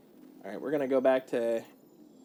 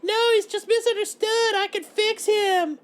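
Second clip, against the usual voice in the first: put-on much higher, loud swooning girl voice; lovestruck girl; 8 dB louder than usual. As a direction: gush like a girl defending her bad boy. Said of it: "the stereotype ... where it's like".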